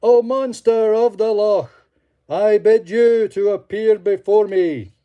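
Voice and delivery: loud, high ringing summons; loudest voice. Acted. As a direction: cry out with full force. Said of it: "cried in his loudest voice".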